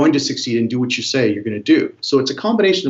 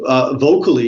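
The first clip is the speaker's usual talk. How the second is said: normal talking voice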